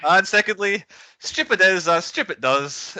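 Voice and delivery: high, slow Alabama drawl between giggles; slow, high, dreamy Southern storyteller introducing guests; Southern drawl impression. Same impression introducing second guest.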